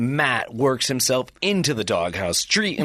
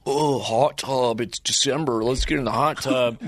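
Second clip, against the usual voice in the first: put-on slow, dumb teenager with Chicago accent. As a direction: drawl it like a goofy Chicago teen. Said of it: "work on your Chicago accent".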